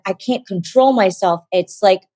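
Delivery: ordinary talk, nothing marked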